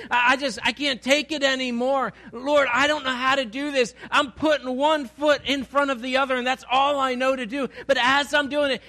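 impassioned prayer. Acted. Preacher voicing a worn-out believer crying to God, impassioned. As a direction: pour it out like a soul at its end. Voices desperate prayer, full force.

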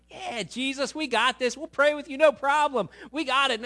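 high, loud, cocky eagerness; overeager assurance. Acted. Disciples promise Jesus they will pray, overeager. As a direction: blurt it out as overconfident followers, high and loud.

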